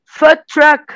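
loud, high American English; American accent. Retold or imitated. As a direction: say food truck like an American child. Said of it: "totalmente no sotaque americano".

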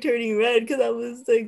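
ordinary talk, nothing marked

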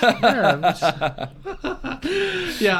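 breaking into high laughter mid-word; laughing. Real, not acted. Amused by the host's quip, laughing high. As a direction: laugh it off, voice jumping up.

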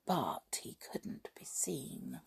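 slow, near-whispered narration; hushed secret. A narrator's reading. Secret aside about being invisible.

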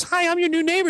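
put-on high, sweet old-lady voice. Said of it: "this lady comes up and goes".

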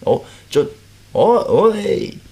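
halting, flustered stammer rising in pitch. Retold or imitated. Startled comedian stammering at his co-star, flustered. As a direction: stumble over the words, caught off guard. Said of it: "ちょっとたどたどしい感じになる".

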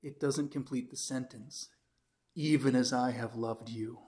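gentle correction. Retold or imitated. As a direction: let him down gently. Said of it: "he was starting to feel sorry for the guy".

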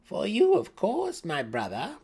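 high, sugary false kindness; sweet as poison; too kindly. A narrator's reading. Brisa answers her brother too kindly, false warmth. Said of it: "too kindly".

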